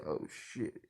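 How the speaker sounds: whispered oh shit; hushed gasp. Retold or imitated. Guild members peek inside, whispering in shock. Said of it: "they look inside and say".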